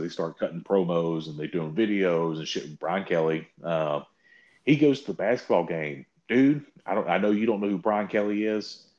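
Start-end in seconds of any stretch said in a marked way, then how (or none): none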